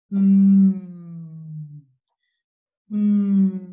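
"M" as a long hummed mmm; letter sound. Shown as a demonstration. Teacher presenting the monito del monte's letter, long hummed "M". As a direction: hum each "M" loud at the start, then fading. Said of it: "a presentarnos una letra".

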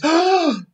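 sharp high mock gasp of shock. Mocking the opponent with a hammed-up gasp. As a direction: gasp like a startled psychic. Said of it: "They're like this".

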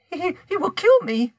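very high, fearful woman's voice; frightened. A narrator's reading. Guilty wife fears her husband will kill her, frightened. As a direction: stammer it out high, in dread.

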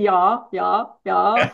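a hint of a German "ya, ya, ya"; mock German "ya". Critic mocking the film's German ya's, lightly. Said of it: "the, the ya's".

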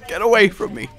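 normal talking voice

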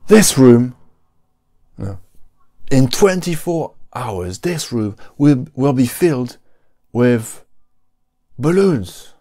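high, hyped-up vlogger opening; YouTuber intro voice. Pretend. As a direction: sell it like a clickbait video intro. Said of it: "Another one".

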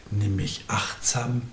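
tonloses, zartes Flüstern; tender whisper. Acted. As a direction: plead softly, almost voiceless. Tender plea of the beloved. (zärtlich geflüstert)

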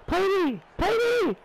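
high yelled call for the ball. Real, not acted. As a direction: shout for the pass across the pitch. Calling for the pass.

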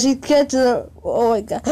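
ordinary delivery

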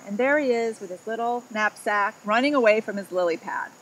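plain reading voice

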